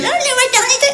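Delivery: high, chirpy mock clerk; mocking receptionist. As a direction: gush it brightly like the receptionist. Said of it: "elle m'a donc dit ça".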